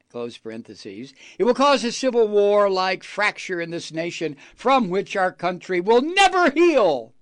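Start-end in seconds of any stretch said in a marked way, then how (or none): 1.4-7.1 loud indignant reading. Retold or imitated. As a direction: read the quote louder, rising outrage. Angry reading of quote.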